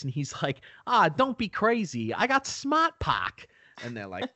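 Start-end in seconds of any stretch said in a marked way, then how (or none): 0.9-3.5 very high, exaggerated Boston accent; Krasinski. Driver boasts to doubting pals, thick Boston accent. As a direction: brag in broad Bostonian, stretching the last words. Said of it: "like, a thick Boston accent".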